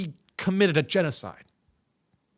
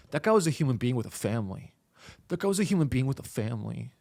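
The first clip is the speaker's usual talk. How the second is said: low, rapid mock reproach; imitated apologist; 4 semitones lower, 6 dB quieter, faster than usual. Bro defending a man with a family, imitated apologist. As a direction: imitate a scolding bro, quieter and pitched down. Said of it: "You go".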